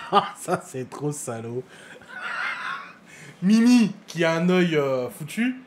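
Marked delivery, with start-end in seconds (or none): 1.7-3.4 high shrieking burst of laughter. Real, not acted. Laughs hard at eye joke. (fou rire aigu)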